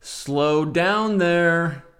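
exaggerated drawn-out read; slow-motion drawl. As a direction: stretch every word out hugely. Exaggerated repeat of the comment.

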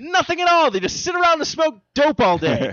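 high, loud comic outrage; worked-up, pitched-way-up rant; ranting shout. Real, not acted. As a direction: yell the indignant punchline. Comic rant about useless wasps.

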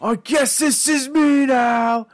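very high, drawn-out comic yell; mock wailing shout. Joking about a lousy shopping-cart job, mock wailing shout. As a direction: howl it slowly, hamming up the defeat. Repeats joking cry, bigger.

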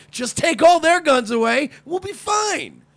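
high, loud sarcastic pitch; sarcastic mock proposal. Mock proposal about police guns.

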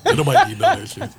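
laughing. Real, not acted. Laughing at being teased.

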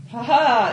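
loud, heroic "ha ha!"; short mock-heroic laugh; triumphant laugh. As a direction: crow it out like a bold adventurer. Performed triumphant cry about adventurers.

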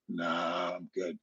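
low, soft, offhand nah; dismissive brush-off. Retold or imitated. Retelling brushing off his sister, soft and dismissive. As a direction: wave off the sister's suggestion.

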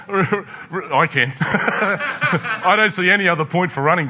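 chuckling as you tease a friend; amused laughing aside. Real, not acted. Teasing Bill, laughing.

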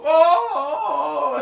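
loud high startled oh oh; falling cry. Acted. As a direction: yelp while tumbling down. Dwarf falls into snow.

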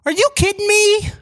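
high, strained mock-outraged coach yell; Jim Mora impression. Friend mimics a coach's famous rant with high mock outrage. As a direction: shriek it like the incredulous coach at the podium. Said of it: "Favorite, famous quote?".

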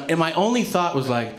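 usual speaking voice

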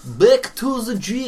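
slow, loud, high hammed-up Russian accent on "Back to the gym"; mock Russian accent. Trying out a Russian accent for mates, hammed-up Russian accent. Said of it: "That's a Russian one".

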